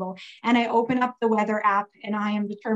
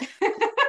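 a sudden high laugh right after a word; a high, bubbling laugh; 13 semitones higher than usual. Real, not acted. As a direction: break into laughter mid-sentence. Laughing after apologizing to friend.

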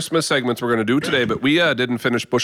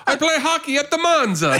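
much higher hammed-up Italian accent; laughing parody of an Italian accent; goofy Italian accent; mock Italian accent; 11 semitones higher than usual. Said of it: "sounds like he's Italian".

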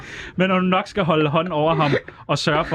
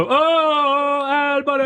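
high, half-hearted shouted catchphrase; echoing the shouted clip line, lightly; mock yell; 13 semitones higher, much slower than usual. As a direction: toss off the yelled catchphrase. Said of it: "går igennem billeder og råber".

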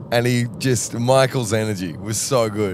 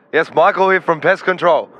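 higher, louder caricature of a tradie; Michael's voice; 9 semitones higher, 6 dB louder than usual. Retold or imitated. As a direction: put on a chirpy tradie voice. Impersonating Michael on phone.

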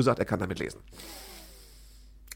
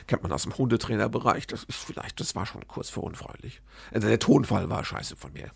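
ordinary talk

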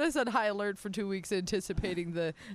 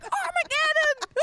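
high, slow, drawn-out cry of doom; mock panic; 4 dB louder, slower than usual. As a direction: ham up the end of the world. Panicked earthquake reaction.

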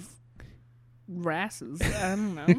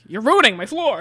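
annoyed Larry; 7 dB louder than usual. Retold or imitated. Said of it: "Larry's like".

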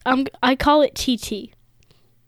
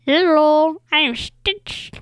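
put-on alien creature voice, higher than usual; Stitch; 4 semitones higher than usual. Kid showing off an impression on the podcast, Stitch voice. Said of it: "Can you do a Stitch voice?".

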